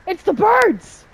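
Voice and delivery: high, loud alarmed cry; panicked yell. Real, not acted. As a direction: shout the alarm. Birds attack in game.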